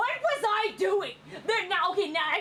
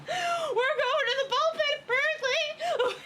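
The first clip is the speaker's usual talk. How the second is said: high, drawn-out mock wail; incredulous high repeat; 8 semitones higher, slower than usual. Real, not acted. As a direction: wail it slowly, high and half laughing. Exasperated repeat of earlier line, laughing.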